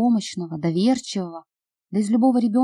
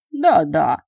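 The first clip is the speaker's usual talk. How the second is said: отмахиваясь; 3 semitones lower, 7 dB louder than usual. Acted. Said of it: "отмахнулся мародёр".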